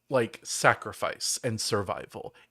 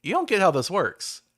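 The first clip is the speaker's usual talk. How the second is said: mock gang leader; 7 semitones higher, 3 dB louder than usual. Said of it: "the guy just shakes his head and goes".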